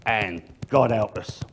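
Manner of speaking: ordinary delivery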